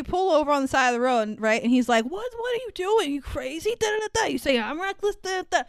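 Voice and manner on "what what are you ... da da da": high-pitched mock disbelief; mock agitated. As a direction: rattle off a retold argument, high and worked up. Retold roadside quarrel, blah-blah filler.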